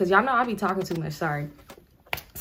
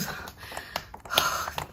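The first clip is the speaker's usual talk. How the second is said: long breathy swoon; dreamy sigh; 9 dB quieter than usual. Retold or imitated. [name] remembering how fine he looked, dreamy sigh.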